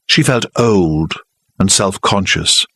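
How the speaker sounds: ordinary talk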